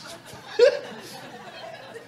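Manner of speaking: self-mocking giggle; high breathy laugh. Real, not acted. Preacher laughing at his own craziness: high giggle. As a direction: burst out laughing at yourself. Laughs at himself, maybe nuts.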